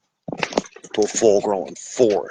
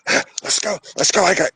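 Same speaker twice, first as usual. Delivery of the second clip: mock frantic digging; 8 semitones higher, faster than usual.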